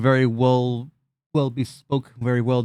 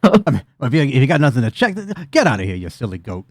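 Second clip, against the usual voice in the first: goblin host, joking; 5 dB louder than usual. Acted. Goblin host to a guest with nothing to check, joking dismissal. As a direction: playfully shoo the guest away.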